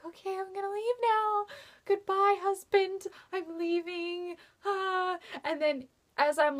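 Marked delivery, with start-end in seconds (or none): 0.1-5.2 much higher, sing-song mock farewell; flustered fangirl goodbye. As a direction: play it as a giddy fan backing away. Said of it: "and so I was like".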